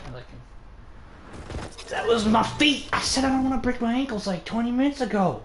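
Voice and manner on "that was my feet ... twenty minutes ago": high-pitched, indignant outburst; exasperated gamer complaint; exasperated protest. Real, not acted.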